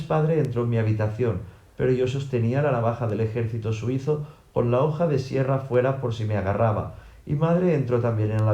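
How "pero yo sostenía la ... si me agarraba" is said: ordinary delivery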